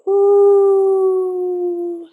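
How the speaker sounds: long, high, steady whale "ooh"; whale call. Shown as a demonstration. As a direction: hold an ooh like whale song. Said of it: "make the sound of a whale".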